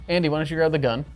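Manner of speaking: usual speaking voice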